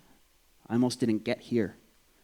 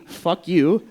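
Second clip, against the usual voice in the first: higher, louder mock yell; raised-voice recap of a yell; retold outburst; 6 semitones higher, 6 dB louder than usual. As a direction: replay the curse loudly without screaming. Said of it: "what they heard outside was".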